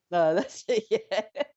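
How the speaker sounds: real laughter breaking into the words; laughing. Real, not acted. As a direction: laugh as you give up on the point. Laughing at Richard being wrong.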